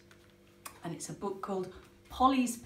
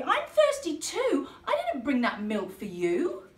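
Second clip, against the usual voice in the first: cross Polly; 6 semitones higher, 6 dB louder than usual. Acted. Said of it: "cried Polly".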